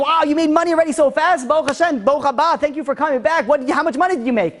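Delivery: high-pitched, gushing mother's welcome; excited stream of praise and questions; delighted greeting. Retold or imitated. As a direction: act out an overjoyed mother. Mother greets returning son with delight.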